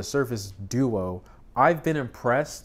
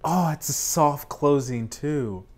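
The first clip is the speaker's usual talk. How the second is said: ordinary talk, nothing marked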